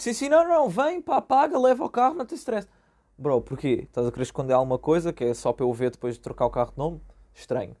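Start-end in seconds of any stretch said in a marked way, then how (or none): 0.0-2.7 pushy seller, pitched way up and louder; overeager seller. Retold or imitated. Seller desperate to get rid of the car, pitched way up and eager. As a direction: gush like someone desperate to unload a car. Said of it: "Ou então tem aquele tipo".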